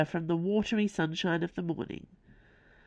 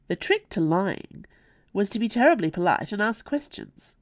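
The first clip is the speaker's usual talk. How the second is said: plain reading voice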